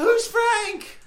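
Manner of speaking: high loud bewildered cry; panicked confusion. Acted. Philippe confused by the name, high loud panic.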